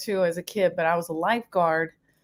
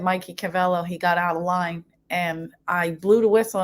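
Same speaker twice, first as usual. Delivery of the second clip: ordinary talk, nothing marked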